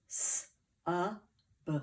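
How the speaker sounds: S-a-b as three separate sounds, sss, uh, buh; sounding out. Shown as a demonstration. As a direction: sound out S-a-b one sound at a time. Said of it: "Let's build the first syllable".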